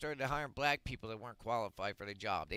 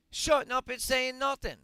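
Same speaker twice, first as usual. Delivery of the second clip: furious shout; 10 semitones higher, 8 dB louder than usual. Real, not acted. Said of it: "Yes, I'm fucking mad".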